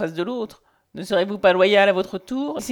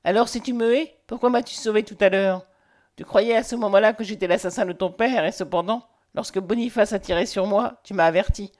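ordinary delivery

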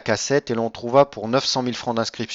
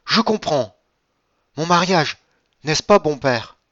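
higher and louder, struck by understanding; a granddaughter suddenly grasps her grandfather's meaning, exclaiming; sudden exclamation; 6 semitones higher, 5 dB louder than usual. A narrator's reading. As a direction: exclaim it as the truth dawns on you. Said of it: "s'écria tout à coup Valentine".

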